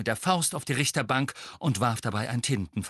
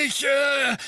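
loud sputtering outburst; raging; 6 dB louder than usual. A narrator's reading. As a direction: blurt it in a rage. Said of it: "tobte Fudge".

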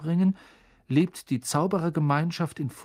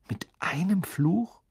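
whisper; 5 semitones higher than usual. Acted. Harry whispers a shocked question.

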